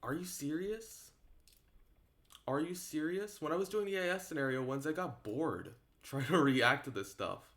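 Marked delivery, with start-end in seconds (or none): none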